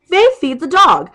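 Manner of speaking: imitating shouting back at a friend; loud mocking retort. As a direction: hammer "Faith feeds the dog" as a loud, scornful put-down. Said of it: "That's what it is".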